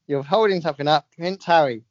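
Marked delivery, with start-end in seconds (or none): none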